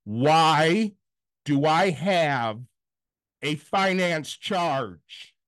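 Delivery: loud WHY, then slow, spaced-out words; slow, exasperated retold demand; emphatic demand. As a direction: draw out each piece of the question with pauses. Retold argument with card agent.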